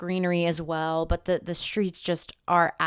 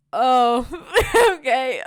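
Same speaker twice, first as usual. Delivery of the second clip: higher, louder, a laugh breaking into okay; shocked little laugh at being turned down; laughing in disbelief; 6 semitones higher, 8 dB louder, slower than usual. Real, not acted. Laughing off the manicurist's blunt no, surprised.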